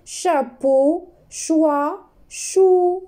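"Du chocolat" drawn out, then the words rising; teacher modelling the reading; slow reading. Shown as a demonstration. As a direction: read the list slowly for the class. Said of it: "Je répète ici la lecture".

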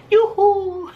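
high-pitched yippee from Chopper; excited cheer. Acted. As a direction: celebrate like a delighted little reindeer. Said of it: "Se pone a celebrar Chopper".

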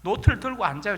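normal talking voice

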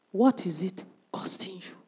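hushed whisper to the congregation. Acted. Preacher whispering a challenge to the church. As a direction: lean in and breathe the question. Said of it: "whispered challenge".

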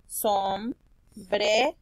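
sombrero slowly split as som, bre, ro; syllable by syllable. Shown as a demonstration. Teacher showing the syllables, sombrero slowly split up. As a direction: leave a gap after som and bre in sombrero. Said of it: "aquí está la primera sílaba".

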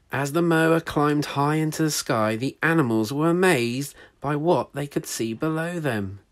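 lively read-aloud storytelling voice; story narration. A narrator's reading. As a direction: read it like a picture book to children.

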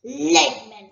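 loud, scornful bark of Lakemen; angry dragon. Acted. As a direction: spit out Lakemen with contempt. Dragon spits the name.

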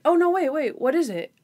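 lightly voiced man stopping her leaving; eager Dennison. Suspect calls Natalie back, mock eager. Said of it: "And he's like".

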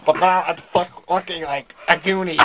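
normal talking voice

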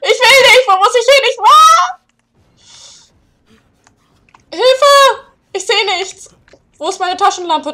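high, loud terrified shrieks; panicked screaming. Real, not acted. Gamer flees the nightmares, screaming in terror. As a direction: scream like you're being chased in the dark. Fleeing monsters in the dark.

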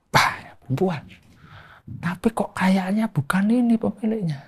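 hushed unvoiced whisper. Real, not acted. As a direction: whisper it, afraid of being heard. Scared alone at night. (berbisik ketakutan sendirian di malam hari)

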